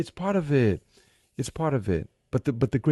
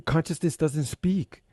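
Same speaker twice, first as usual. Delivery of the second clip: ordinary talk, nothing marked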